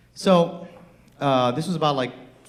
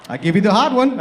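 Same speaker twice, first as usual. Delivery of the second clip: emphatic offer; 7 semitones higher, 8 dB louder than usual. Retold or imitated. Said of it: "He's like".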